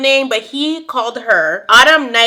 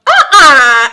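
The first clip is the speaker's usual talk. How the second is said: loud uh-uh; 4 semitones higher, 10 dB louder than usual. Real, not acted. Outraged reaction to the commercial.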